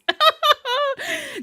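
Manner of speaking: squealing laugh at flying cards; delighted high laugh. Real, not acted.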